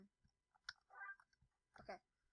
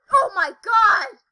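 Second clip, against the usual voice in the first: startled shout; 6 semitones higher, 18 dB louder, slower than usual. Real, not acted. Scared by creepy thing in game.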